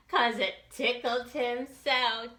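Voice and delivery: plain reading voice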